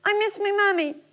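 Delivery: high, stammering, close to tears; stammering girl. A narrator's reading. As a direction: stutter like a frightened child. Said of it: "And the girl said".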